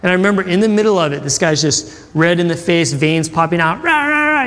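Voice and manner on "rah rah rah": high-pitched wordless mock rant; imitated ranting. Preacher imitating a red-faced man, high mock ranting. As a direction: mimic an angry tirade in nonsense syllables.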